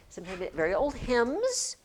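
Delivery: ordinary talk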